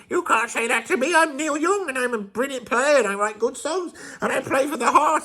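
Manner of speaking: high thin voice, fast, laughing; weedy voice. Retold or imitated. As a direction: whine it in a reedy squeak. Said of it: "I don't know what this voice is I'm doing".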